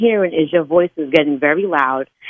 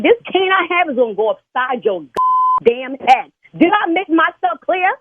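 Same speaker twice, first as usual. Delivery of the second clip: high-pitched furious telling-off; real angry threat; 4 semitones higher than usual. Real, not acted. Church signer threatens the caller who criticized her, real anger. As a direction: lose your temper and threaten them.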